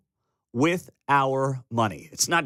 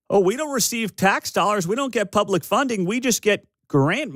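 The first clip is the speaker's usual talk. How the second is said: higher-pitched mock-innocent protest; mocking paraphrase; 7 semitones higher than usual. Host parodying the development director, mocking. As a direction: parody the director's excuse in a raised voice.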